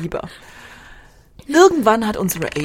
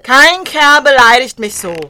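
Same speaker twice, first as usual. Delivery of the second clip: loud, raised, indignant fury; enraged; 5 semitones higher, 10 dB louder than usual. Acted. Attacker has just stabbed the man who insulted her, enraged. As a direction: lash out loudly at the insult.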